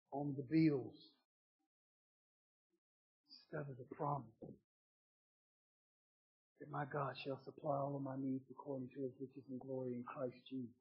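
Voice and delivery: very soft, low, intimate; hushed preaching. Acted. Preacher softly warning the congregation about the bills, very quiet. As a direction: bring it down to a near-whisper.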